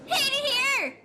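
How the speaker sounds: high, strained scream of suffering; a big anguished screech of despair. Real, not acted. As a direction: wail it like you're being tortured. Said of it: "my suffer scream is too funny".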